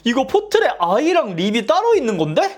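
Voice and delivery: high, loud rising question; surprised realization. Real, not acted. To viewers, realizing eye and lip versions differ, surprised.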